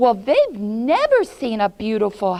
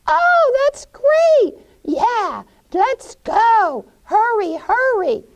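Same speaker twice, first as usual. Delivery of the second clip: put-on very high, loud eager kid voices; children thrilled about food and candy; 8 dB louder than usual. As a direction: squeal and urge everyone to hurry.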